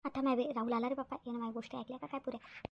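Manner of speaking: worried she was overheard, say it under her breath; hushed aside. Acted. Chandrika worries Rahul overheard her, hushed aside.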